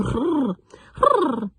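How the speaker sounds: rolled hrr with voice, sliding up; tongue flutter. Shown as a demonstration. Coach demonstrating the flutter warm-up, rolled hrr. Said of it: "you're gonna add sound".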